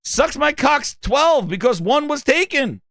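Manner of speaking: high, slow mock explanation; earnest gamertag fan. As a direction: spell it out proudly in a high voice.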